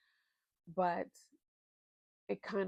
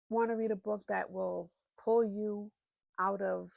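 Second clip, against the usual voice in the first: ordinary talk, nothing marked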